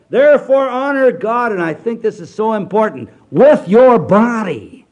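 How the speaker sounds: loud, high, slow pulpit proclamation; full-force revival preacher; preaching at full force. Acted. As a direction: thunder the scripture out slowly.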